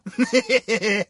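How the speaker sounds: short smug chuckle; pleased little laugh after a jab; gloating laugh. Acted. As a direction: laugh at the target's expense. Handler gloats over heist.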